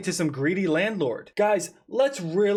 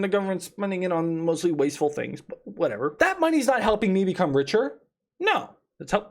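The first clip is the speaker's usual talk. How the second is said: usual speaking voice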